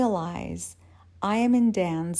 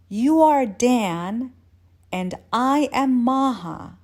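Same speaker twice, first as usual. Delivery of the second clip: dazed realization; 4 semitones higher, 6 dB louder than usual. Acted. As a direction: stretch it out like a childlike revelation. Dazed woman proudly works out names.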